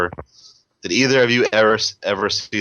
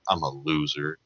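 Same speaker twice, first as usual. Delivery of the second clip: much softer, lower and deflated "I'm a loser"; downtrodden kid; 5 semitones lower, 9 dB quieter than usual. Retold or imitated. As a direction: mope it out like a defeated kid. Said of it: "the kid says it really just downtrodden".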